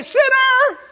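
high shouted call of the judge; mock referee shout; judge yelling. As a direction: yell it across the field like a referee. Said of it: "He would yell back".

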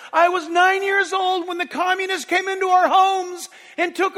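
loud, high, pleading retelling of her words; old Russian woman weeping. Retold or imitated. Preacher voicing the old Russian babushka, loud and tearful. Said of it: "she grabs me by the face and she says".

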